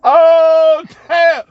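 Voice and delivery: loud, high whoop of amusement; exclaiming after laughing. Real, not acted. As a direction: cry it out, still tickled by the memory. Storyteller cracking up at the memory.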